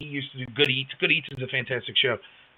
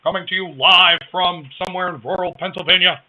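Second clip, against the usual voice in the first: radio announcer; 4 semitones higher, 7 dB louder than usual. Acted. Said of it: "Sounds like a nineteen thirty radio's broadcast".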